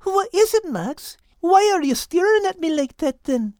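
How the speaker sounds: a warm brogue-tinged lass; cheerful Kate. Acted. Kate voiced in story.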